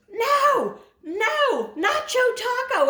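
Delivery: very high, loud mock yell; squealed long-distance shout; imitated screaming. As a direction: imitate a kid hollering in protest. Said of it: "He was screaming".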